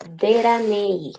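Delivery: Deranei said slowly, each syllable clear, with a tapped r; slow Korean reading. Shown as a demonstration. Teacher sounds out the name Deranei slowly for a student. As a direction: spell out Deranei slowly, de-ra-nei. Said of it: "sino deranei".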